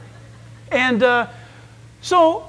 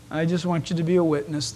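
ordinary talk, nothing marked

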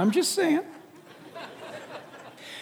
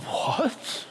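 mock shock; 9 dB quieter than usual. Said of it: "it's like".

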